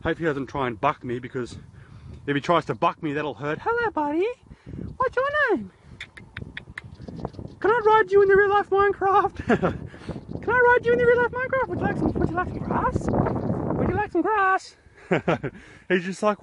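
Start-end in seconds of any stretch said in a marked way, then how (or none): 3.6-5.7 very high, sweet pet voice. Real, not acted. Talking to the horse in a high baby voice. 7.6-9.5 very high and louder coo. Real, not acted. Asking the horse for a ride in high baby talk. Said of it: "repeats question". 9.5-14.8 very high, sweet pet voice. Real, not acted. Talking to the horse in a high baby voice.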